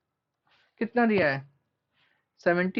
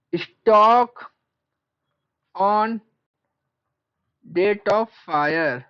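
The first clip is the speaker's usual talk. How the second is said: word by word, long pauses; slow dictation; 4 dB louder, much slower than usual. Real, not acted. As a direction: stretch each word for note-takers. Dictates heading while writing it.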